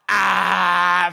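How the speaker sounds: short shouted "Ah" with a finger point; mock victory yell. Drunk fan gloating at the bar crowd, shouting. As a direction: yell in triumph at the room. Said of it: "I'm literally doing the".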